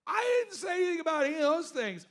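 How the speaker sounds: high, loud exasperated protest; exasperated shout. Retold or imitated. Protesting to accusers, a high loud exasperated shout. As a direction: shout that you never said it.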